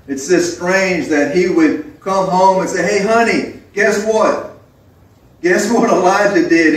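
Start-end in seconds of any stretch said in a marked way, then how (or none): 2.8-6.7 excited, higher-pitched husband with news; aufgeregter Ehemann. Acted. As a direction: burst to tell your wife, pitched up. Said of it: "he's so excited".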